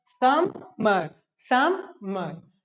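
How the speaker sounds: "समर" broken into sum, pause, mer; split syllables. Shown as a demonstration. Teacher drilling two-syllable words, "समर" split as sum - mer. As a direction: say each "समर" slowly in two separate beats. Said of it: "दो syllable से बने हैं".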